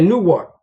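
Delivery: "Newark" as "Noo-wawk", no R; local New Jersey pronunciation. Shown as a demonstration. Driver showing listeners how Newark people talk, local pronunciation of "Newark". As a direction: drop the R in "Newark", local style. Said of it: "That's how they say it".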